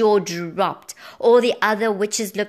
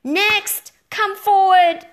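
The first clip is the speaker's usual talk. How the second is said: high, loud witch's yell; 11 semitones higher, 9 dB louder, much slower than usual. A narrator's reading. Witch summoning the next toad, yelling. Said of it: "she yelled".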